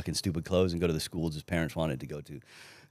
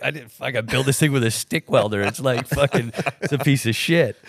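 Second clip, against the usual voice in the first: mock exasperated rant; 6 semitones higher, 4 dB louder than usual. Retelling own thoughts, amused.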